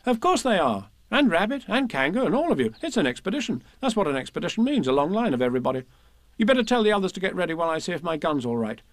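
put-on brisk, high-pitched boy in charge; confident young leader. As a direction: give orders to the party with easy confidence.

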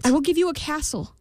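high, anxious queen offering gold; pleading queen. A narrator's reading. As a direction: plead with the imp. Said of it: "said the queen".

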